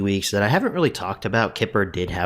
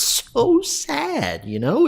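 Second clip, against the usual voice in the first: high, slow, cracking voice of someone about to cry; real tears pushing the pitch up; voice breaking; much slower than usual. Real, not acted. Host tells viewers the show is ending, voice cracking with tears. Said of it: "I do apologize for getting a bit emotional".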